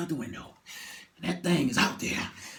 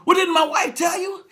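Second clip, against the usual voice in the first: high, loud indignant question; indignant shout; 11 semitones higher, 7 dB louder than usual. Retold or imitated. Passenger shouting back at the pilot. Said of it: "He said".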